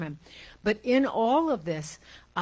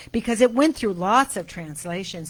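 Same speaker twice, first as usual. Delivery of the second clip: usual speaking voice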